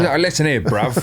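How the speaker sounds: tossing off a cockney bruv; hammed-up British accent; mock British accent. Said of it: "do it in the accent".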